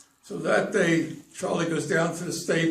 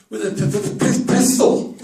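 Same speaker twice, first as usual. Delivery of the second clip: higher, louder, popping repeated p sounds; stuttered punchline; 5 semitones higher, 8 dB louder than usual. Acted. Charlie tells the owner how to get lead in, stuttered punchline. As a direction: stutter on the p, then burst out loud.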